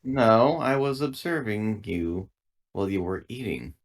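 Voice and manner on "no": usual speaking voice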